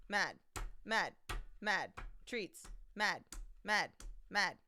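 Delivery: loud, separate one-word bursts; imitating talking buttons. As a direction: punch each word out like a button press. Imitating cat hammering word buttons.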